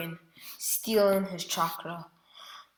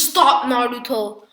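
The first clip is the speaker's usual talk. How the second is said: loud, higher plea to stop; pleading shout; 4 semitones higher, 9 dB louder than usual. Acted. Hinata begging Naruto to stop, loud pleading shout. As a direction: cry out to make him stop. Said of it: "And she starts crying".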